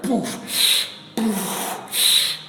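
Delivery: real effort, breath forced between words; straining while lifting. Real, not acted. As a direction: push each count out on a hard exhale. Counting reps while lifting heavy weights.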